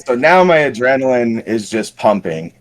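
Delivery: ordinary talk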